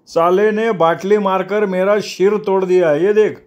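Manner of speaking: high-pitched, worked-up complaint; riled up. Acted. Injured man shows his split head to the gang boss, riled. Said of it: "अंत्या जागच्या जागी चावताळला".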